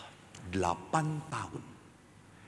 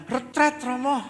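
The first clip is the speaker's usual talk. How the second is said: put-on high, loud child's answer; eager schoolchild; 7 dB louder than usual. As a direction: answer brightly as a little kid. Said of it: "Saya ketemu anak S D".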